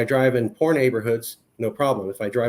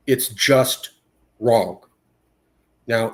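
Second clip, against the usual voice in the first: usual speaking voice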